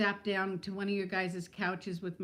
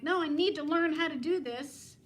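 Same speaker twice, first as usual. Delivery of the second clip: higher, louder retold snap of frustration; mock angry reply; 6 semitones higher, 3 dB louder than usual. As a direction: replay the mad comeback, a bit raised. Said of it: "And then I get mad".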